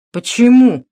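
offended protest. A narrator's reading. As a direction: take offence at the question. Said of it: "обиделся Кагановский".

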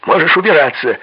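high, loud furious shout; yelling. A narrator's reading. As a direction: throw him out with a roar. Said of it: "заорал Мэддокс".

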